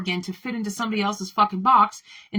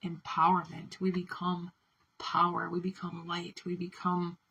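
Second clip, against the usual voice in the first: softer, tender, gently reassuring; soft reassurance; 5 dB quieter than usual. Acted. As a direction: speak softly to someone who is hiding. Soft reassuring address to listeners.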